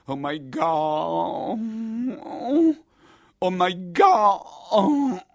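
slow, stretched cries of a stabbed man; English cry, dragged and breaking into a shout; dying gasps. Acted. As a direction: groan it out as you fall bleeding. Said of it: "rantolà con le mani sul panciotto".

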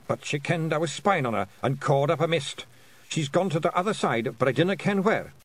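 high Scottish pictsie telling what happened; pictsie pilot. A narrator's reading. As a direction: report it in a high Scots voice. Voices pilot reporting.

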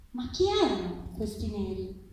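plain reading voice

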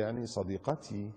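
slow, soft, drawn-out mimicry; mock coy boyfriend. Preacher quotes a man excusing his girlfriend, mock coy. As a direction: say it slowly and softly, mock-sweet.

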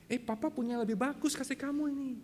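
high, sing-out parental bargaining; coaxing father. Acted. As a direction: pitch it up to tempt a small child. Father bargaining with small child. (ayah membujuk anak dengan nada tinggi)

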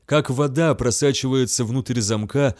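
ordinary delivery

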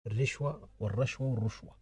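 الرشوة said three times, first with a, then i, then u on the first letter: rashwa, rishwa, rushwa; three vowel forms. Shown as a demonstration. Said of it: "مثلث".

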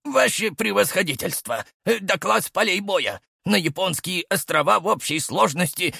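high, out of breath report; breathless. A narrator's reading. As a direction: gasp out the urgent report. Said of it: "задыхаясь, быстро заговорил".

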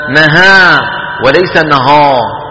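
ordinary talk

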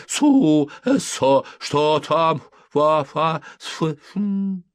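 high, loud babble in short bursts; shouting sleep-talker. A narrator's reading. Sleeper challenges intruders from his dream, loud babbling threat. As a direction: blurt nonsense loudly, then fizzle out. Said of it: "угрожающе вскрикнул спящий".

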